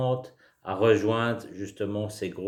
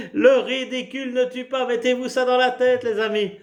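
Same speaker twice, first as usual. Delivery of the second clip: laughing while talking; 14 semitones higher, 5 dB louder than usual. Real, not acted. Laughing teacher, high voice.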